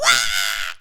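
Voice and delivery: rasped cartoon Toad squawk; Toad impression screech. Showing off their Toad impression, squawked screech. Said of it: "That was my Toad impression".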